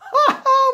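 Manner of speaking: very high, loud staged shriek of laughter; hammed-up high ha-ha; woman's laugh. A narrator's reading. Woman nearly falling over at the idea, laughing. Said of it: "she was laughing so much".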